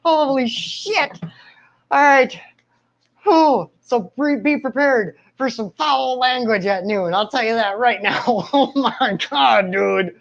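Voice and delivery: high, tight voice still hurting; high strained voice after the shock; strained pain. Real, not acted. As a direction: talk through the lingering sting. Talking while still shocked.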